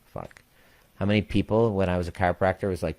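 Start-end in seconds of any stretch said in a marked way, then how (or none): 0.1-0.4 low and much softer, swallowed curse; a quiet inner groan of a curse; muttered curse under breath. Retold or imitated. Inner reaction to exemption claim.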